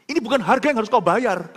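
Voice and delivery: inward protest. Retold or imitated. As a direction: preach it as an urgent protest. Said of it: "saya protes di hati saya".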